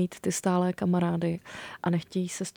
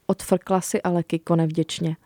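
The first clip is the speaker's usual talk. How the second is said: put-on reading a children's book aloud; story narration; 5 dB louder than usual. A narrator's reading. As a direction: tell it warmly to young listeners. Author reads her book aloud.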